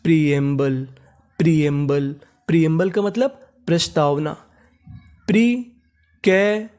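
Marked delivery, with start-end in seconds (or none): 5.3-6.7 drawn out. Shown as a demonstration. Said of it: "teacher introduces word".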